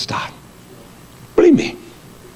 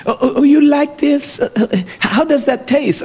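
lightly voiced fawning cashier, high; a hint of sugary flattery, higher; mock flirty cashier; 10 semitones higher than usual. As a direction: cashier sweet-talking the preacher, mock flirty. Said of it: "you silly woman".

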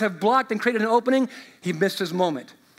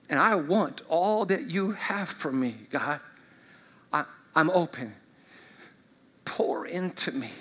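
soft prayer; 5 semitones lower, 6 dB quieter than usual. Acted. Closing a voiced surrender prayer.

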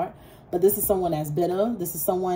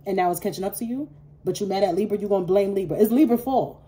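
ordinary talk

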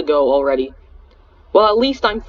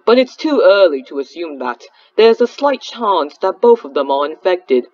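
put-on British accent. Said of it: "I was gonna do a British accent for Mister P".

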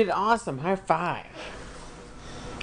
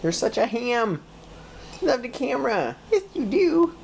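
put-on baby talk; 10 semitones higher than usual. Parent cooing at toddler on camera.

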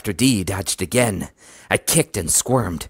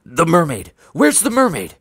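loud, high shouts climbing higher; urgent raised shout; frantic yelling; 9 semitones higher, 6 dB louder than usual. A narrator's reading. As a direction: yell in panicked outrage. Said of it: "I heard the kidnappers yelling".

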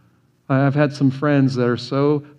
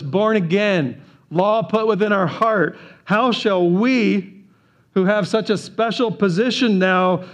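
higher, climbing preacher's appeal, slowed on each "how shall we"; preaching at full force; 6 semitones higher than usual. Acted. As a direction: build the question to the congregation. Building rhetorical appeal to church.

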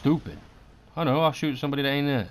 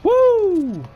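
very high, loud celebratory woo; triumphant woo; 8 dB louder than usual. Real, not acted. Gamer celebrating his match result, a triumphant woo. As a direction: whoop of victory.

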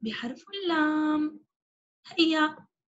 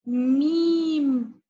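drawn-out letter name; 4 dB louder, much slower than usual. Shown as a demonstration. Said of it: "حرف".

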